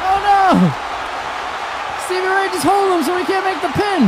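alarmed shout. Real, not acted. Alarmed reaction to game move.

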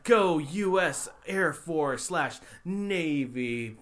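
slow, drawn-out rah-rah chant; hammed-up military cheer, stretched slow; mock patriotic cheer. Parodying military recruitment ending.

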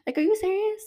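high, stunned question; incredulous. Real, not acted. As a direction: ask it in outraged disbelief. Disbelief at photoshop accusation.

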